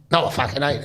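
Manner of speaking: put-on Cockney at an even volume; Cockney accent. Retelling his denial to a big Forest lad, Cockney accent. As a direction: answer in Cockney, "naow" for no. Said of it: "it was Cockney accent".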